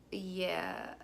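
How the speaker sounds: low, very soft reply; sheepish admission. Retold or imitated. Woman admitting she went through his wallet, sheepish. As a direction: own up in a small, low voice.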